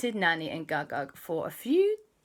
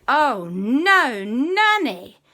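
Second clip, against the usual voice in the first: dismayed cry; 6 semitones higher, 7 dB louder, much slower than usual. Acted. Family sees giant Nanny.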